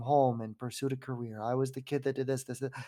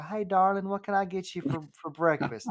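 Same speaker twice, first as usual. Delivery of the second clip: higher-pitched Southern diner greeting; Southern twang; 6 semitones higher than usual. Retold or imitated. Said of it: "hearing the Southern twang".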